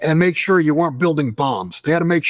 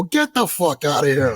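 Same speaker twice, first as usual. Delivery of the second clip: Eddie Murphy. Retold or imitated. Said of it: "full on Eddie Murphy".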